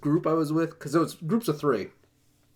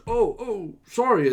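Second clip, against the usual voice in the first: higher-pitched mock apology; flustered apology; 7 semitones higher, 5 dB louder, slower than usual. Stoned teammate apologizing for ditching the fight, flustered. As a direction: imitate a sheepish teammate caught out.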